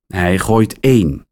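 plain reading voice

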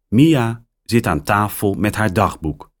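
usual speaking voice

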